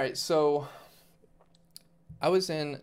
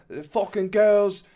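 loud, high drawn-out imitation; mock fighter rant; 7 semitones higher, 6 dB louder, slower than usual. Co-host mimics the fighter's nonsense rant, loud mocking imitation. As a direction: belt it out like a hyped-up fighter.

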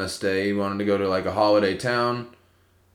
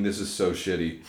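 usual speaking voice

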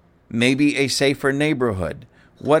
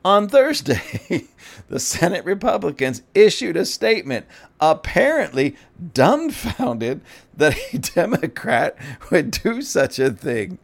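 laughing reread; 4 semitones higher than usual. Real, not acted. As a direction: savor every word through laughter. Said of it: "let me read that again".

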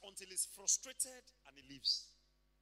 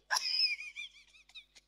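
loud, very high burst of laughter; long helpless cackle; uproarious laugh; 10 dB louder than usual. Acted. Acts bursting out laughing at complaint.

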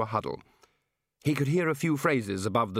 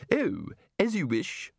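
genteel lady; 6 semitones higher, slower than usual. Acted.

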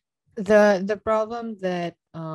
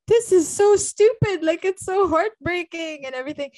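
high, loud mock exasperated complaint; imitating mom fed up with the sad book; mom's mock frustrated rant; mock exasperation; 10 semitones higher, 6 dB louder than usual. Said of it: "even she's like".

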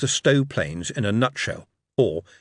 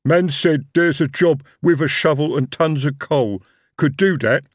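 louder, plodding troll voice; 4 semitones higher, 5 dB louder than usual. Acted. Young troll speaks.

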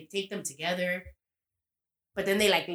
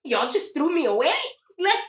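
indignant quote; 12 semitones higher, 7 dB louder than usual. Retold or imitated. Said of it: "And he's like".

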